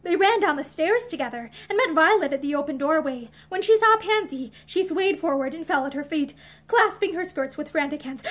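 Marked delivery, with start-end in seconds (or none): none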